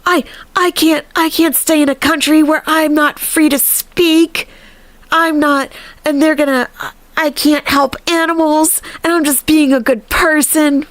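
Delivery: high-pitched, upset mimicry; mock freaked out. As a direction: imitate her upset protest in a high voice. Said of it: "She gets all freaked out".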